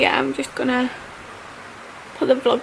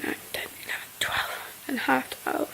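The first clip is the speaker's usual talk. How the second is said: whispering; 10 dB quieter than usual. Real, not acted.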